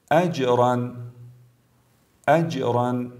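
recited اجرا with echoed ج; brief qalqala. Shown as a demonstration. Tajweed teacher gives example of jim, bouncing ج in اجرا. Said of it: "مثالی از جیم".

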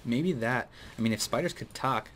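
ordinary delivery

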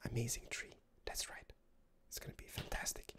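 soft secretive whisper. Acted. Hushed aside about the tree.

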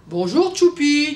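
a hearty, booming doctor's greeting; big voice. A narrator's reading. The doctor greeting Tchoupi with his big voice. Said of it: "avec sa grosse voix".

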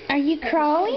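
ordinary talk

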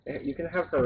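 normal talking voice

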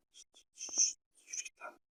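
whispered hushing, go away; whispered shoo. Real, not acted. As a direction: hiss it softly at the enemy. Shooing an enemy player away.

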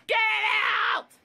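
yelling through tears; crying yell. Real, not acted. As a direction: shout it out half crying. Mother yelling, near tears.

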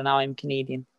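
ordinary delivery

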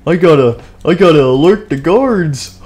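high, loud alarm. Acted. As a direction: sound the alarm in panic. Guard spots intruder, alarmed.

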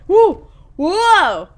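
loud, high exclamations; excited whooping. Real, not acted. Player reacts to the game action, real excited whoop. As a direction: whoop out in surprise, pitched well up.

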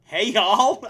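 loud, high Texan greeting; Texan accent. Shown as a demonstration. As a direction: belt out a Texan "y'all". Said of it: "I got the y'all right".

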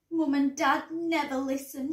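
plain reading voice

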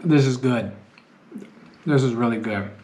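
chewing between words; talking while eating; mouth partly full. Real, not acted. Seen 0: Took bite just before; lips pursed, chewing motions between phrases.

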